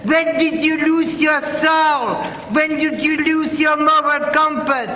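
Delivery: ordinary talk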